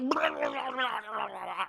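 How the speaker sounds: mock agonized gibberish; melting monster babble. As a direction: babble like a man melting into a creature. Melting man's garbled noises acted out.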